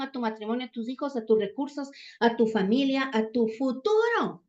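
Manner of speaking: normal talking voice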